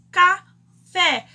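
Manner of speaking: "Café" loud, split, with FÉ punched; final-syllable stress. Shown as a demonstration. Teacher giving an example of oxítonas, loud stressed ending. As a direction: call out "Café", hammering the last syllable. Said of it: "oxítonas terminadas em E são acentuadas".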